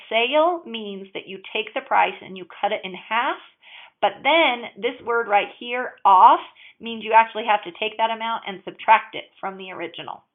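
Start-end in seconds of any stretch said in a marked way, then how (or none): none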